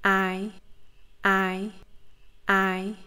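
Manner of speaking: "I" said as a glide from the first vowel to the second; Vietnamese diphthong. Shown as a demonstration. Teacher drilling the diphthong three times for students, careful and clear. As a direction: repeat the "I" glide slowly and clearly. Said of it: "move your mouth to the position of the vowel".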